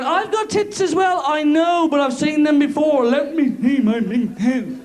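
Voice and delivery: high, slow mock girlfriend voice. To his mates, mock girlfriend, high and slow. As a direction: lovers teasing in a mocking high voice. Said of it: "your lovers are going".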